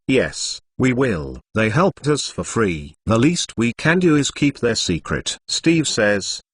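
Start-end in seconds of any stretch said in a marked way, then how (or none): none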